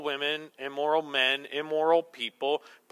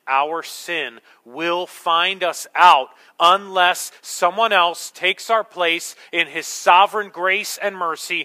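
slow, louder, pounding each phrase; forceful preaching; 2 semitones higher, 7 dB louder, slower than usual. Acted. Preacher warning the congregation of God's wrath, slow forceful preaching.

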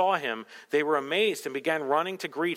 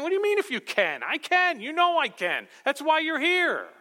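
emphatic reassurance; 9 semitones higher than usual. Acted. Jesus answering the doubting father, insistent and emphatic. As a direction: press it home with certainty. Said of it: "Jesus is saying".

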